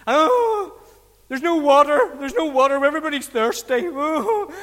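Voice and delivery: hammed-up tearful whinge; gurning complaint. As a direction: moan and gurn like a hard-done-by crowd. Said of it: "They gurned".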